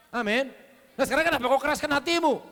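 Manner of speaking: loud, high challenge to the hearers; fiery rebuke. Real, not acted. Preacher rebuking the congregation's hard hearts, loud. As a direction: throw the question at them, loud.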